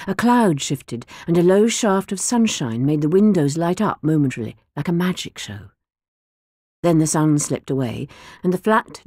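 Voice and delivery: usual speaking voice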